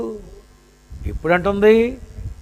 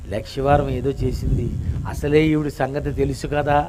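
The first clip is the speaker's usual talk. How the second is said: much lower, much softer pleading murmur; hushed plea; 9 semitones lower, 12 dB quieter than usual. Pretend. Wine inside her body, hushed pleading for her. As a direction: murmur it inward like a secret plea.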